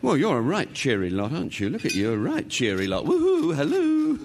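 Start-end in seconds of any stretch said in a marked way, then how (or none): 3.0-4.2 high loud teasing hoot; hammed-up hoot. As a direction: tease them into answering. Teasing silent table, calls out.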